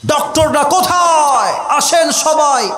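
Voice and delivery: high, urgent call for doctors; acted shout. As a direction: shout across a distance for help. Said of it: "আর চিৎকার দিয়ে বলছে". (চিৎকার করে ডাকার অভিনয়)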